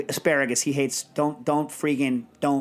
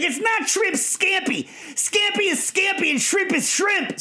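very high, drawn-out mock tirade; hammed-up conniption, pitched sky-high; restaurateur going crazy; slower than usual. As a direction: imitate a man losing his mind, word by hammered word. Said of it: "So he would go crazy".

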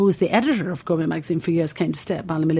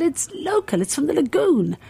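a hint of a higher Italian stallholder; lightly voiced stallholder, pitched up; 9 semitones higher than usual. A stallholder correcting a tourist, lightly voiced higher. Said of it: "I can't do an Italian accent".

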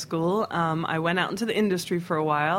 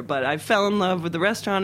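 ordinary talk, nothing marked

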